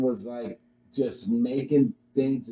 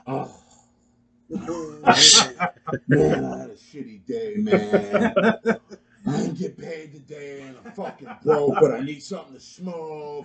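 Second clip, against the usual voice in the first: mock customer voice; 3 semitones higher, 6 dB louder than usual. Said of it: "is that really what I sound like?".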